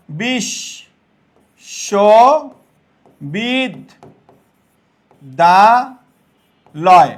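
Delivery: বিশ্ববিদ্যালয় stretched very long, syllable by syllable; extremely slow. Shown as a demonstration. Teacher sounding out the Banglish spelling very slowly. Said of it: "Banglish ভাষায় এরকম".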